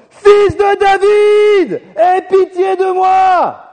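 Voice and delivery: very high, slow, drawn-out shout, desperate; desperate beggar shouting over the crowd, slowly. Retold or imitated. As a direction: cry out as hard as you can, begging for mercy. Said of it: "Il a crié encore plus fort".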